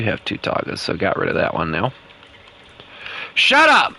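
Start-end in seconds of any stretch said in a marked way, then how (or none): 3.3-3.9 high-pitched outburst; sudden shout. Real, not acted. Cutting off a teasing friend with a high shout. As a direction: yelp it out sharply.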